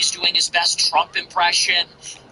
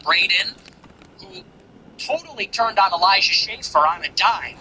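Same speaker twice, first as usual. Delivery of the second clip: ordinary talk, nothing marked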